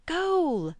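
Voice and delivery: shrill game cry Goal!; high excited shout. A narrator's reading. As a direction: scream Goal! in triumph.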